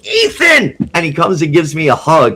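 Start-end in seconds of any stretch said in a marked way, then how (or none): 0.1-0.8 calls the name out high and bright; high, louder glad shout of a name; happy greeting. Retold or imitated. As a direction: imitate a star greeting a friend warmly. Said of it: "He's like".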